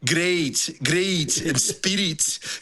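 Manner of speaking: imitated thick hard-to-follow accent; mock accent, words barely intelligible; Steve-O Caldwell. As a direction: mimic a colleague's baffling accent. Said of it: "I never understand a word that he's saying".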